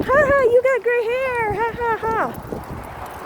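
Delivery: high mocking schoolyard taunt; taunting kids. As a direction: tease like a cruel grade-school kid. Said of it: "kids are going".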